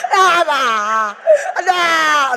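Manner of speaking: loud, laughing panicked cries; panicked child. Retold or imitated. Child panics when mother says the owl has arrived. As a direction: shriek in mock fear.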